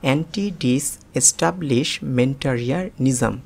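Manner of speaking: Anti ডিসএস্টাবলিশমেন্টারিয়ানিজম drawn out slowly, syllable by syllable; slow, part by part. Shown as a demonstration. Teacher slowing anti ডিসএস্টাবলিশমেন্টারিয়ানিজম for learners. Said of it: "আমি আবারও বলছি".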